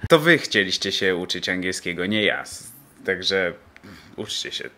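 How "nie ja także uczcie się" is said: ordinary talk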